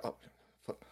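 quiet muttering zombie voice; zombie scroller. Retold or imitated. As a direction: drone it half-asleep. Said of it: "And they're like".

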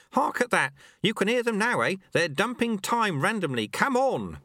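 high, lively alarm; urgent old master. A narrator's reading.

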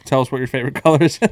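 laughing mock YouTuber plea. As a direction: giggle through a fake hype line. Mocking YouTuber engagement bait.

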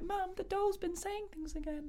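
put-on high little girl voice; child voice. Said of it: "she's all her life been like".

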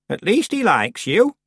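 lightly voiced old woman, slow and teasing; a hint of a sly old witch. A narrator's reading. Old Miss Treason teases Tiffany, lightly voiced old woman.

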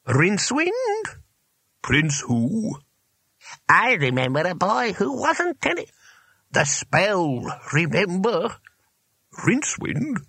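high, puzzled wizard voices; high-pitched, doddering puzzlement; querulous old wizards. A narrator's reading. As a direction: squabble like muddled masters behind a door. Old wizards whispering behind the door.

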